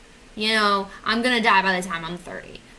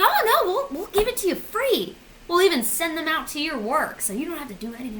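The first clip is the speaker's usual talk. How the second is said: high, eager customer-service voice; insurance agent; 6 semitones higher than usual. Acted. Agent promising a free windshield fix, over-helpful. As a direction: gush the offer in a high chirp, easing down.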